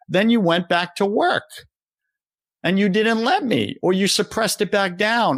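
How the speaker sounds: a hint of a loving father pleading with his child; voicing God. Coach voicing God to a struggling listener, tender and urgent. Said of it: "No, God's saying".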